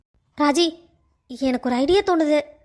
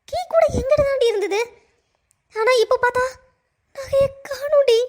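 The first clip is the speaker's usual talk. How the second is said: tearful distress; 7 semitones higher than usual. Acted. Raji upset to friend.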